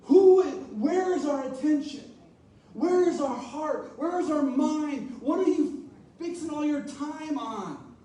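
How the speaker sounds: preacher pressing the congregation; searching preaching. Acted. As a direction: challenge them to look inward.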